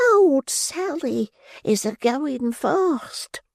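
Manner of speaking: put-on high, slow old crone voice; quavering withered old woman; old pauper woman. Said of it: "a withered old female pauper".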